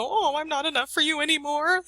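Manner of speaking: high, hammed-up self-pitying whimper; mock tearful. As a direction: play up the hurt mother, half joking. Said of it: "tears down my face".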